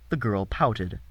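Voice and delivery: ordinary delivery, nothing marked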